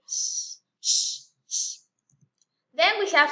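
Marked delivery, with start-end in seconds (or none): 0.1-1.8 a long unvoiced sh, repeated; hissed sh. Shown as a demonstration. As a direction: hiss out sh, sh, sh. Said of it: "tiene ese sonido de".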